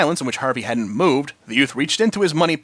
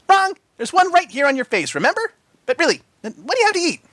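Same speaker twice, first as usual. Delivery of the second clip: joking Pinky. A narrator's reading. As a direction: tease with a grin. Pinky jokes.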